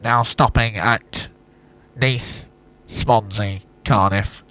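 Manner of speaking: slow platform announcement voice; train station announcer. Shown as a demonstration. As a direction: announce the stops slowly. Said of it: "What's your train station voice?".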